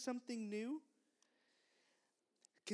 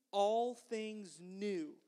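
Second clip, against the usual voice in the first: slow, measured call for repeating; slow recitation; much slower than usual. Shown as a demonstration. Teacher leads children in saying it together, slow recitation. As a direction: say it slowly so all can join in. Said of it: "Can you say that with me".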